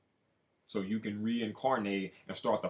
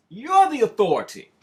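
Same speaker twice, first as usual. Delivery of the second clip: higher, much louder declaration; loud proclamation; 12 semitones higher, 14 dB louder than usual. Acted. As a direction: call it out to the whole room. Loud call, then quiet echo.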